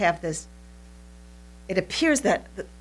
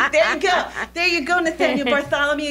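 high, loud words through laughter; laughing; 7 semitones higher, 4 dB louder than usual. Real, not acted. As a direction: still laughing at the joke. Laughing at own tattoo joke.

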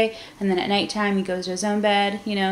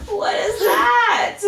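high, slow, laughing question; 11 semitones higher, much slower than usual. Real, not acted. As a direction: laugh through the words. Laughing at daughter's antics.

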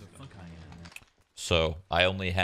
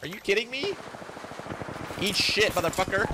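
furious shout. Real, not acted. Streamer raging at the game.

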